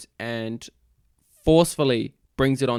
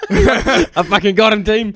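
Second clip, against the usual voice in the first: laughing; 9 semitones higher, 7 dB louder than usual. Real, not acted. Laughing triumph over friend.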